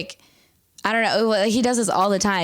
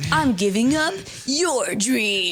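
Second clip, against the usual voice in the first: slow mock melodrama; 5 semitones higher, much slower than usual.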